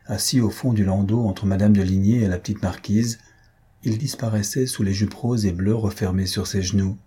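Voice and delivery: ordinary delivery, nothing marked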